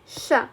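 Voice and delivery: "sha" said as a separate short sound; isolated SH sound. Shown as a demonstration. Teacher showing all these letters pronounced alike, isolated SH sound. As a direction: set "sha" apart as a phonetic demo. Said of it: "pronounced like".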